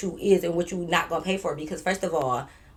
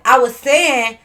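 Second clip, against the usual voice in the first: louder, punchy opening; raised voice opener; loud start; 4 semitones higher, 9 dB louder, slower than usual. Retold or imitated. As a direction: start loud then drop. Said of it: "the first word you get loud".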